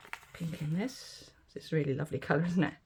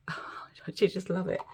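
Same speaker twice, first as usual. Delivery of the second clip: laughing whisper; 3 semitones higher, slower than usual. Real, not acted. Admiring the pink resin.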